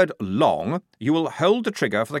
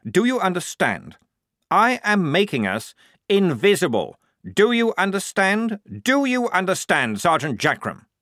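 higher, insistent, each question pressed harder; pointed scolding; 7 semitones higher, 4 dB louder than usual. Acted. Lieutenant rebuking the sergeant in front of the squad, insistent. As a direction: drive the point home again and again.

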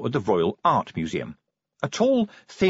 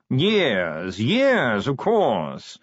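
posh yawning drawl; much slower than usual. Acted. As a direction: drawl every word like a yawn. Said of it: "modulated yawning".

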